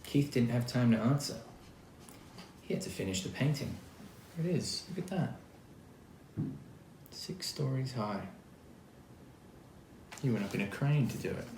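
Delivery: ordinary delivery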